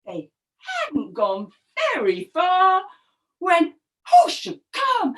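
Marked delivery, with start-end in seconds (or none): none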